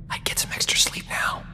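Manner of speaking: low whisper to a companion; hushed aside. Acted. Doctor advising his companion to rest, whispered. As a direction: whisper it like private advice.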